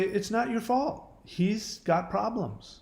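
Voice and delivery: acted tender reassurance, lifted in pitch; reassuring. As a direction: soothe a hurt little kid. Acting out comforting a hurt child.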